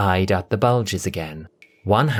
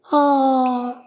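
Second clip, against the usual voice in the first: very high, small mournful ah; a little creature mourning; Chip's sad sigh. Acted. Chip mourns at the empty frame.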